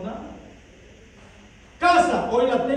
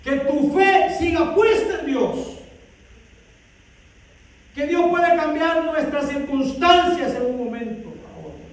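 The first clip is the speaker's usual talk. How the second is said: fervent preaching; 4 semitones higher, 5 dB louder than usual. Acted. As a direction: drive the faith home, full voice. Preaching climax on faith. (predicación enérgica y lenta)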